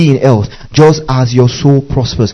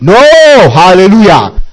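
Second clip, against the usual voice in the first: drawn-out, louder hallelujah cry; emphatic no; 4 semitones higher, 5 dB louder, slower than usual. Real, not acted. Pastor rebuking a congregation at ease with sickness, drawn-out shout.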